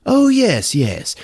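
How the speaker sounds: high mock-British "oh", dropping after; British dean. British dean answers the lieutenant, high mock accent. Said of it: "So the dean is British".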